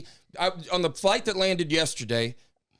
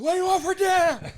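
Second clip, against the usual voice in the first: high, hammed-up shout; mock yell, pitched way up; raised-by-an-airport yell; 14 semitones higher, 4 dB louder than usual. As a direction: holler it as if over airport noise. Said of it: "that's why I talk like this".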